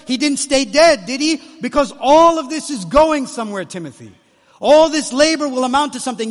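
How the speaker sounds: loud, high, driving sermon delivery; full-force preaching. Real, not acted. Preacher urging Timothy that the labor will amount to something, impassioned preaching. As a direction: preach it out at full voice, building on each repeated phrase.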